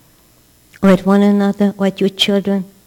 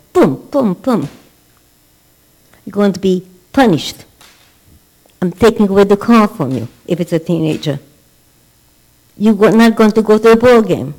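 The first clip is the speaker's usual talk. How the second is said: angry father; 4 dB louder than usual. Retold or imitated. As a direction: lay down the punishment like a furious dad. Angry father punishing teenager.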